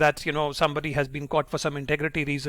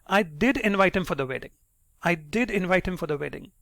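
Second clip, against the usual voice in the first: "did" stressed above the other words; insistent stress on "did"; emphatic did. Shown as a demonstration. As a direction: punch "did" to insist it happened. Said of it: "using the emphatic do".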